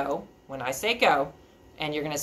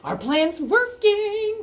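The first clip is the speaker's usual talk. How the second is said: high, slow singsong goat voice; middle goat; 5 dB louder, much slower than usual. Acted. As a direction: stretch it out high and gleeful. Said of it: "Middle Billy Goat Gruff said".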